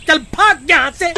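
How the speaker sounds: angry, high-pitched order; irritated dismissal. Acted. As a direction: chase the wife off crossly. Husband chases wife off. (गुस्से से भगाते हुए)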